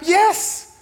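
high, loud insistence; emphatic yes. Acted. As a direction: answer the doubter with total certainty. Jesus insisting to doubting follower.

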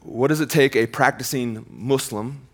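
ordinary talk, nothing marked

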